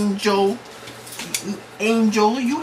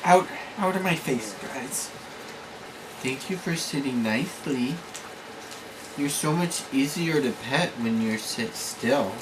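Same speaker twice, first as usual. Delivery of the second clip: quiet soothing voice; 12 semitones lower, 7 dB quieter than usual. Real, not acted. Calm low talk to pets.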